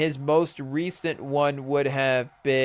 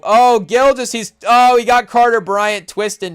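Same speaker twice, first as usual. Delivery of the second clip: louder, higher excited reaction; excited play call; 5 semitones higher, 8 dB louder than usual. Real, not acted. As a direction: call the play as it happens, thrilled. Live game excitement, reacting.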